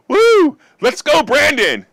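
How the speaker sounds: high, loud whoop into a drawn-out chant; celebratory cheer. Real, not acted. Cheering the end of mask rules.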